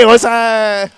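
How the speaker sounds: rising, wailing majlis lament, slowed on the cries; preaching at full force. Acted. Reciter wailing Zahra's call to Husain at full force.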